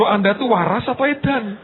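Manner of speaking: high, incredulous teacher scolding; exasperated teacher. Retold or imitated. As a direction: tease the child like a baffled teacher. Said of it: "Kan gurunya bilang". (guru heran setengah menegur dengan nada tinggi)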